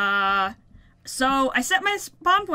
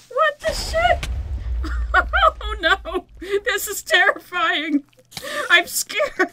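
high, squeaky scared voice; mock terror; 7 semitones higher, slower than usual. Real, not acted. Gamer blinded in the dark biome, high mock terror. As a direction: play up the fright, half laughing.

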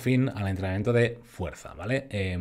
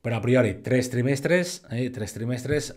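ordinary delivery

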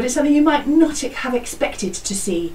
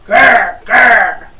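loud growling grr; teacher roaring as the grizzly bear; 7 dB louder than usual. Shown as a demonstration. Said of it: "he might see a grizzly bear".

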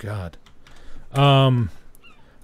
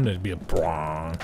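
imitating a low orchestral hit; slow deep sound effect; low slow brong noise; 8 semitones lower, 6 dB quieter than usual.

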